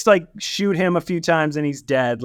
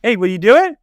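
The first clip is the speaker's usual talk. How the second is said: higher, louder call-out; mock eager recruiting; 6 semitones higher, 6 dB louder, faster than usual. Prison boss picking the next person to send in, mock eager. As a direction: call out to the next volunteer, playfully pushy. Acted casual recruiting, willy-nilly.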